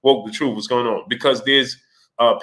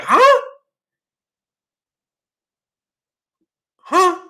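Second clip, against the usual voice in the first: very high, loud baffled huh; a shocked double take; 4 dB louder than usual. Retold or imitated. Reacting to the commenters, a high baffled huh. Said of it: "and I'm like".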